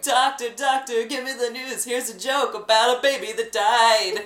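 high, loud hammed-up comedian delivery; mock joke-teller. Mocking a former doula telling a baby joke, high and loud. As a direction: sell a terrible joke with big showy gusto. Mocks a tasteless way to announce.

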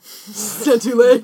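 loud slow teasing. Real, not acted. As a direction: tease it out slowly.

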